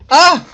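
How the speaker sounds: sudden loud, high yelp; startled cry. Acted. Player reading the game's startled reaction, a loud high yelp. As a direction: cry out sharply, caught off guard.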